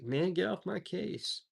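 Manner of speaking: high, dismissive modern-slang brush-off; casual brush-off. Acted. Peter brushing off the servant girl, annoyed and casual. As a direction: wave off the accuser like a pest. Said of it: "modern American vernacular".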